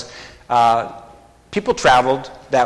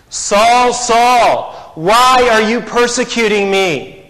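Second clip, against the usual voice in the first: loud, high, longing cry of the name; longing call; 7 semitones higher, 5 dB louder, slower than usual. Acted. Jesus calling out to Saul, loud and longing. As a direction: call him with aching longing. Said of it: "There's a longing there".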